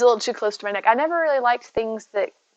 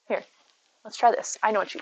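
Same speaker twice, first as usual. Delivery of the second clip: ordinary talk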